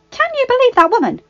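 high, loud, outraged; angry, high-pitched outburst; furious. A narrator's reading. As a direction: burst out indignantly, pitch shooting up. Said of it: "she said furiously".

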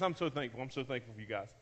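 fighting back tears as he thanks them; real tearful gratitude, barely above a breath; choked-up thanks. Real, not acted. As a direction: voice dropping low and soft, near tears. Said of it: "I'm trying not to cry right now".

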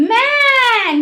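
"ম্যান" high and held on a long vowel; pronunciation demo. Shown as a demonstration. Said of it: "নিজেরা নিজেরা উচ্চারণ করো". ("ম্যান" উঁচু সুরে টেনে উচ্চারণ)

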